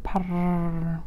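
low, soft purr. Acted. Oscar happily purring, low and soft. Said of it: "he started to purr".

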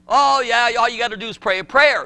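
loud, breezy parody of a lax teacher; loud mock casual reassurance; mocking false teaching. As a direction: wave it off like it's easy. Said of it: "But we're being told".

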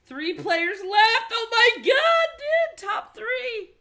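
very high, loud slow shriek; excited shriek. Real, not acted. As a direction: scream in victory. Reaches final three.

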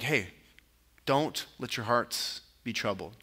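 calm them gently, keeping the voice down; gentle. Retold or imitated. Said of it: "it's probably a gentle".

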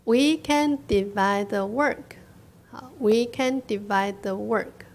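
slow, evenly spaced words; teacher modelling a sentence for learners; slow model reading. Shown as a demonstration. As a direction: read it out slowly for repeating. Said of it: "跟我念".

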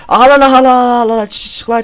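high panicked screams; real fear, yelling; terrified screaming. Real, not acted. Monster spotted, panicking.

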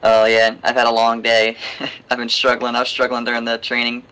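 ordinary talk, nothing marked

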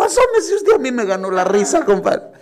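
high, loud, laughing through a lie; laughing compadre. Acted. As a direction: squeal it out laughing to trick his friend. Beaten compadre hiding pain.

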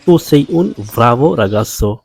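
very slow, each word laid out clearly; slow teacher demo; slow model reading. Shown as a demonstration. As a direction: spell the sentence out slowly for learners. Teacher models Italian sentence.

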